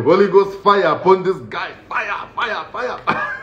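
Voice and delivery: hammed-up fire-calling, high and breathy at the end; mock deliverance prayer. Comedian recalling praying against the inmate, mock fiery preaching. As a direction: call down Holy Ghost fire like a preacher. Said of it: "I was calling".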